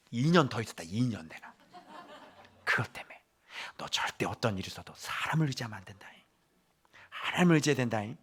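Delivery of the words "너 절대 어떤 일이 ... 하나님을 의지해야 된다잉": hushed, dialect-tinged grandpa warning; grandfatherly warning. Acted. Grandfather warns his grandson never to trust people, whispering. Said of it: "이런 양육을".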